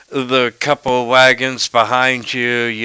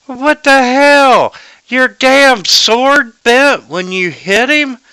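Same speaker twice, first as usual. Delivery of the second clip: high-pitched, exasperated scolding; game master's merchant tearing into the guards, acted; chewing them out; 14 semitones higher, 6 dB louder than usual. Merchant chewing out hired guards over a sword, high scolding. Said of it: "he's just chewing them out".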